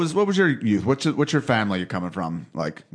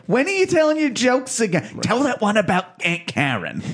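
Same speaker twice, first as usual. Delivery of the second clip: high, keen relative voice; squeaky excited auntie; high mock eager relative; 11 semitones higher than usual. Imitating family.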